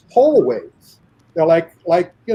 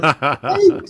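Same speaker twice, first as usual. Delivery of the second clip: laughing thumbs-up; 7 semitones higher than usual. Retold or imitated. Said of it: "puts up his thumb and goes".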